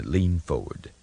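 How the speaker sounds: ordinary delivery, nothing marked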